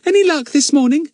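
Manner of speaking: put-on high, bright-eyed girl greeting a friend; young girl voice. Betts greeting Fatty back at the shed, pleased girl voice. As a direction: lift into a young girl's voice, pleased.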